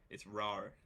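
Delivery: Rar with the A drawn out long; accent on the A. Shown as a demonstration. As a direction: stress and stretch the A in Rar. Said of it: "you need to accentuate the A".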